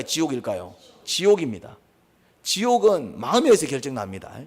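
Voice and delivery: emphatic sermon delivery, pressing each question home; forceful preaching. Acted. Preacher drives the lesson of Cain's heart into the congregation, forceful preaching. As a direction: preach with punched key words and rhetorical questions.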